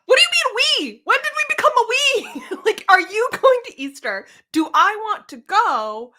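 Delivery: high, loud exasperated questioning; incredulous rant, half laughing; indignant mock outrage. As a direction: fire back in hammed-up disbelief. Acted indignant reaction to boyfriend's text.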